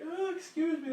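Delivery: apologising through a real yawn; high-pitched, yawn-stretched voice. Real, not acted. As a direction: talk while a yawn takes over. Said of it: "I'm yawning".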